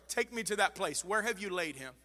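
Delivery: sudden drop to a soft voice; hushed quote of Jesus. Acted. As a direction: quote it gently after the climax. Jesus' words after loud build.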